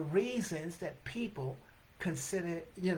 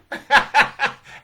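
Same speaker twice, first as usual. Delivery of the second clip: high loud laughing fit; loud burst of laughter; 9 semitones higher, 15 dB louder than usual. Real, not acted. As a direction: crack up hard. Laughing at her own story.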